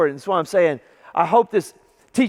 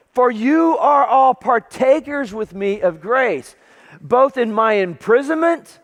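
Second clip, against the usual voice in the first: higher, slow, stretched proclamation; declamatory scripture reading; 5 semitones higher, 3 dB louder, slower than usual. Retold or imitated. Paul writing to his partners in grace, fervent proclamation. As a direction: proclaim each phrase with fervour.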